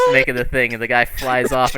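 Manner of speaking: ordinary talk, nothing marked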